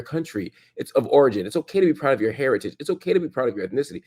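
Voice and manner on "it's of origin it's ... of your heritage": ordinary delivery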